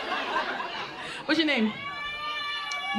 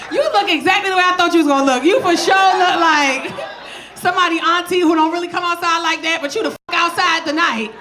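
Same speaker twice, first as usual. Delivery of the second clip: roast her across the room; 6 semitones higher than usual. Real, not acted. Roasting a tipsy audience member.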